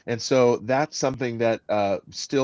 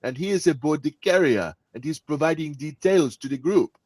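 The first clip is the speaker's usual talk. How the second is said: higher-pitched, lilting Caribbean accent; 5 semitones higher, 5 dB louder than usual. Shown as a demonstration. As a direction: speak up as a Caribbean submariner briefing the group. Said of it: "you've got a Caribbean man".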